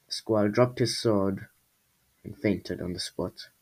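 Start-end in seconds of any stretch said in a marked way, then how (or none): none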